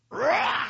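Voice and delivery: loud, high monstrous shriek; demonic scream. Retold or imitated. Woman manifesting a demon in church, screaming. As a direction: imitate a demon erupting in a full-force roar. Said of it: "completely manifested demon".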